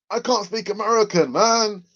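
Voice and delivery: high put-on American accent; attempted American accent. Shown as a demonstration. Host showing off for viewers, hammed-up American accent. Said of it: "give us your best American accent".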